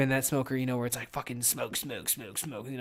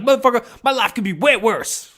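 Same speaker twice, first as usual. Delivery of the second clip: much higher and louder, a hammed-up angry outburst; mock rage; 9 semitones higher, 9 dB louder than usual. Host replaying his own mock rage at listeners in a higher, louder voice. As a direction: replay the fake rant, playfully overblown. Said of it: "I went all rage".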